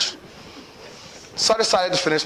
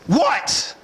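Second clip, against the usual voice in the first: irritated what; 9 semitones higher than usual. Retold or imitated. Irritated husband snapping back.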